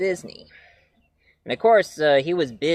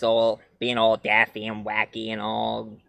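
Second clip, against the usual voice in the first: ordinary delivery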